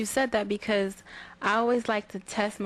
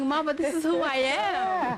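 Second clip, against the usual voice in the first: slow, higher, earnest protest; pleading; 7 semitones higher than usual. Retold or imitated. Daughter defending her poetry to mom, slow and earnest. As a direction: plead your case to mom.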